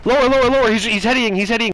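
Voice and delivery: high, loud urgent callouts. Real, not acted. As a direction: bark the warning fast. Urgent callout mid firefight.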